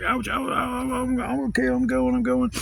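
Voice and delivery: high, whimpering imitation; mock pained surrender. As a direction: yelp in mock pain while giving in. Neighbor in pain gives in.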